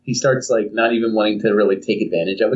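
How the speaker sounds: ordinary delivery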